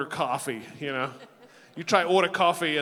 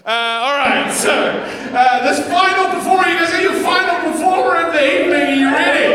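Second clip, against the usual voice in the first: much higher, louder announcer call; emcee hype; 11 semitones higher, 11 dB louder than usual. Acted. Host hyping the crowd for the final performer, emcee call. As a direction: pump the room up for the final act.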